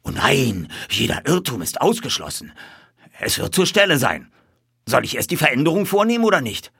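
cowed boss falling over himself to obey; servile politeness. A narrator's reading. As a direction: reassure your new master anxiously. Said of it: "mit unterwürfiger Höflichkeit".

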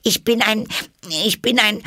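very high, loud, halting start; flustered stammer. A narrator's reading. Alice cannot say what she is, flustered. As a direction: break off and restart in a high voice.

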